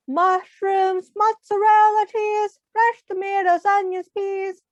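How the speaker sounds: high, loud shouted list. Acted. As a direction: burst in and shout the order. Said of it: "Pinky rushed in and shouted".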